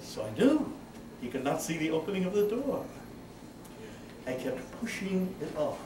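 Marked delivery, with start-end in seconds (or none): none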